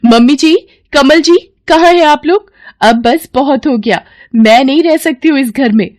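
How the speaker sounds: high, loud indignant daughter-in-law; high-pitched, fed-up shouting; shouting for everyone. Acted. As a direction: yell through the house in outrage. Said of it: "चिल्लाते हुए घर में सबको बुला लेती है".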